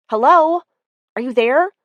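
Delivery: loud, high hello; calling out. Acted. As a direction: call down the line. Calling into the phone.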